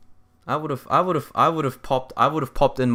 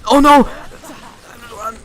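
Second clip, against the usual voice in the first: startled shout; 12 semitones higher, 10 dB louder, slower than usual. Real, not acted. Sudden danger in fight.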